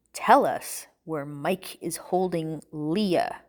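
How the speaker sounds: low, very slow menacing demand; low, threatening. A narrator's reading. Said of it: "demanded in a low and threatening voice".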